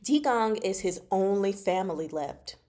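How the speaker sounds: ordinary delivery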